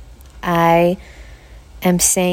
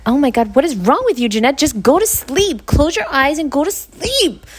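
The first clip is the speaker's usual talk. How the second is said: scolding herself, pitched up and impatient; harsh inner critic; 9 semitones higher than usual. Retold or imitated. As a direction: snap at yourself like a fed-up parent. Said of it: "that is not even my voice".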